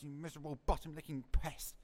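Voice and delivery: ordinary delivery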